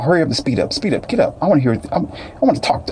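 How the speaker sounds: high mock driver nagging; impatient driver voice. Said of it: "you can just hear them".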